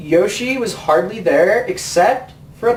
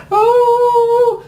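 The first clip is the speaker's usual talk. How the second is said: high, loud, held oh like a broken record; hammed-up high oh that won't stop; stuck high oh; 4 dB louder than usual. Peach calls for Mario but sticks on O, a high held mock oh. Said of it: "like some fucking broken record".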